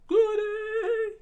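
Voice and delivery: very high and loud, squealed; cartoon cheer from a flashback, very high and loud; high gleeful voice. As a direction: squeal it with delight. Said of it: "He remembered Sanji's smile".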